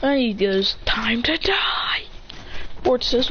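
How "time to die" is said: slow, whispered threat; menacing whisper. Retold or imitated. A ninja slowly whispering a threat over a fallen girl.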